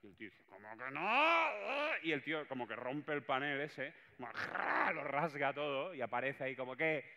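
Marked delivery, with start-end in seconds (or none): 0.4-1.5 loud, slow, crying outrage; outraged boss. Acted. Old boss overhearing his staff, loud wounded wail. Boss bursts out from behind panel. 4.2-4.9 high loud ripping noise; ripping sound. Acted. Boss tearing through the paper screen, loud ripping noise.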